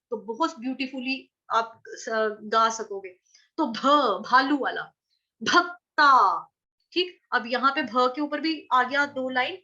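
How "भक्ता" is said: भक्ता slow, क joined into त; slow teaching demo of भक्ता; drawn-out bhakta. Shown as a demonstration. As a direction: stretch भक्ता with the half क. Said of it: "आधा क पूरा त".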